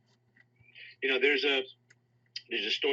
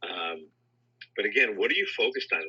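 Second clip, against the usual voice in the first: ordinary talk, nothing marked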